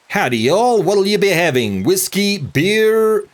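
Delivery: a loose, hammed-up Southern-ish accent of uncertain origin; mystery bartender accent. Bartender greeting customers, in a hammed-up Southern-ish accent of unclear origin. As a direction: put on a half-baked Southern-ish twang, not quite any real accent. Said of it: "I have no idea what that accent was".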